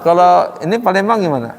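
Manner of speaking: usual speaking voice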